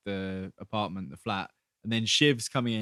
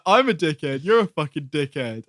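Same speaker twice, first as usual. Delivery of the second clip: loud, higher indignant comeback, imitated; mock quarrel; 4 semitones higher, 7 dB louder than usual. Podcaster retelling George's indignant reply to Shiv, mock quarrel. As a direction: bounce the insult back in mock outrage. Retold quarrel between two characters.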